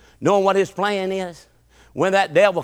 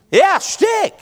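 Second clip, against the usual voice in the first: squeaky punchline; 12 semitones higher, 5 dB louder, slower than usual. Acted. Preacher's mocking punchline.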